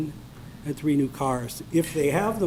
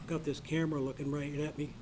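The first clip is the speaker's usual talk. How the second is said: ordinary talk, nothing marked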